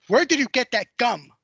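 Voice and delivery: put-on a mother confronting her child over stolen gum; stern scolding question. Mother catches son with gum.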